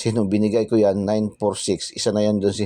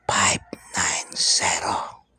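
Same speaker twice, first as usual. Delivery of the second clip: slow hushed whisper, barely voiced; much slower than usual. Shown as a demonstration. Vlogger whispering the lotto tip to viewers, slow and hushed. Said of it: "Bubulong natin sa inyo".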